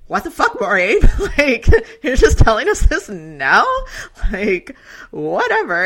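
hammed-up friends blowing up at the late news; indignant outburst. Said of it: "our two Rivers friends are like".

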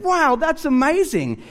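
slow, high-pitched retold wow; impressed amazement. Pastor reacting to salesman's job at the bar, amazed. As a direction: draw it out high, acting amazed.

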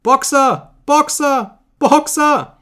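very high, loud repeated cries; screaming. A narrator's reading. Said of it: "schrie sie".

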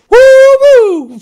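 two high, drawn-out whoops; mock celebratory whooping; freaking-out whoop. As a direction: imitate a prospector whooping from a distance. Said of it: "freaking out".

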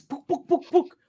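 high popping toy-gun noises; rapid playful "pook" gun pops; imitated Nerf gun shots. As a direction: mimic a toy gun firing. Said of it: "Oh, 'cause I got these Nerf guns".

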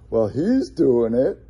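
mock self-justifying, pitch swooping up at the end; acted-out excuse. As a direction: voice someone's excuse with a rising, insistent lilt. Acting out someone's excuse.

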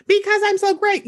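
high, self-satisfied imitation; mock smugness. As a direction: mimic someone very pleased with themselves. Mocking a smug contestant's answer.

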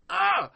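high-pitched exasperated groan; frustrated cry. Real, not acted. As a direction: let out a high burst of frustration. Wordless frustration mid-rant.

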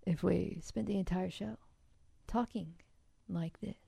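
hushed golf announcer voice; soft, hushed tournament commentary; golf commentator. Shown as a demonstration. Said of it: "like, a golf voice?".

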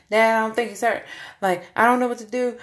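loud, high pleading; panicked and fast; desperate. Retold or imitated. Desperate plea to dad.

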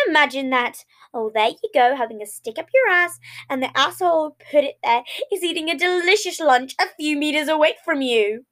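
gleeful, unhinged chatter about gore; manic gremlin voice. Acted. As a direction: rattle it off like a cheerful little maniac.

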